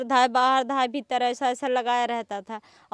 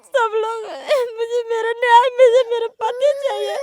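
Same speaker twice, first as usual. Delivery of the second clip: sobbing, higher and louder, begging for justice; real crying plea; 7 semitones higher, 6 dB louder than usual. Real, not acted. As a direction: cry out the words through real tears. Wife pleading for missing husband.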